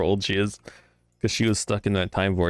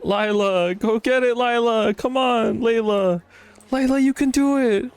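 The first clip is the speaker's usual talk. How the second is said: cheering; 13 semitones higher, 4 dB louder, slower than usual. Acted. Cheering on character.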